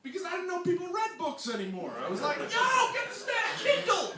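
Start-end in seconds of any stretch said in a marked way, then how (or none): 2.4-4.1 high, loud excited holler; mock calling out. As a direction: shout for help on his behalf, hammed-up. Calls out about reading driver.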